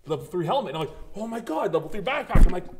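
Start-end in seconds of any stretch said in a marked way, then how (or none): 1.2-2.4 loud, high-pitched retold amazement; excited exclamation. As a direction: relive spotting great loot. Acted thrill at enemy's gear.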